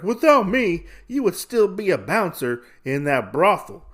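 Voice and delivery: acted taunt. Gambler puts down his partner, taunting.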